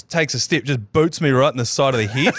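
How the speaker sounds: usual speaking voice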